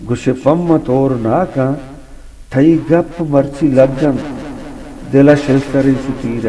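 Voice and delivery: projected verse with deliberate pauses; ghazal recitation. Acted. Poet reciting as the host cries praise, measured declamation. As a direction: declaim each line for an appreciative listener.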